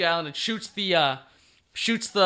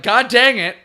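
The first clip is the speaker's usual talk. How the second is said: loud, higher comic outburst; mock exasperation; 5 semitones higher, 10 dB louder than usual. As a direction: curse in hammed-up frustration. Retold hero thwarted yet again.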